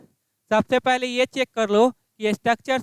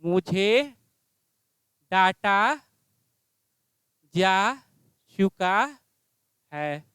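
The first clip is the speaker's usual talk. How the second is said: slow dictation; 4 semitones lower, slower than usual. Shown as a demonstration. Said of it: "क्या होगा?".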